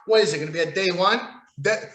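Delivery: usual speaking voice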